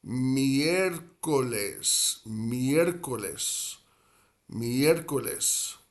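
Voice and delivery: miércoles said slowly as mi-ER-co-les; careful pronunciation. Shown as a demonstration. Spanish teacher repeating Wednesday for learners, careful slow pronunciation. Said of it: "we have a four-syllable word".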